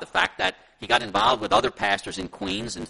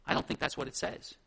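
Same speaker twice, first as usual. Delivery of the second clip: lower, softer gentle objection; loving, kind correction; 5 semitones lower, 8 dB quieter than usual. Acted. Church member correcting the pastor in love, gentle objection. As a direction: disagree kindly and quietly. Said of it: "speak up in love and kindness to say".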